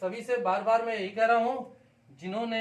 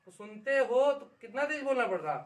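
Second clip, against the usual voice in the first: ordinary talk, nothing marked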